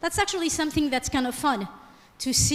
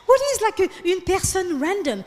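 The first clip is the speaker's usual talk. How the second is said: incredulous; 5 semitones higher than usual. Retold or imitated. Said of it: "I was like".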